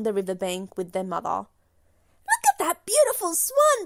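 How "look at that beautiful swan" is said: much higher excited duck voice; high, bright quacky duck voice; quacking ducks. A narrator's reading. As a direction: squeal it like admiring ducklings. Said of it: "they quaked".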